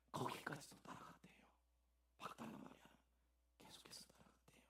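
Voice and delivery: hushed whisper. Real, not acted. Preacher whispering Mary's devotion to the congregation. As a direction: lean in and whisper it.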